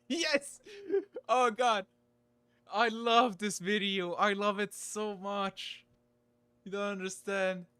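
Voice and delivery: high, loud fan delight; excited. Real, not acted. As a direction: squeal with real joy. Thrilled at favourite video starting.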